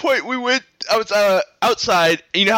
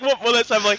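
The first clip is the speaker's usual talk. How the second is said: ordinary talk, nothing marked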